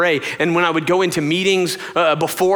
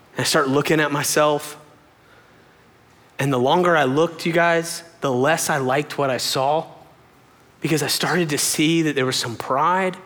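ordinary talk

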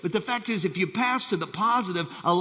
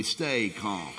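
calm low; 10 semitones lower, 6 dB quieter than usual. Shown as a demonstration. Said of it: "Everybody just shout that with me".

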